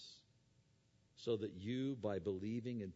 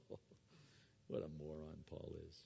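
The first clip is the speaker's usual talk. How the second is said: mocking laugh; 7 semitones lower, 11 dB quieter, much faster than usual. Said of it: "they mocked, started laughing".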